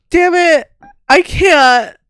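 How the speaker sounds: high, loud, tearful voice; real crying. Real, not acted. Wife breaking down over one line of his story, tearful. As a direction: fight back the tears. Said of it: "stop crying".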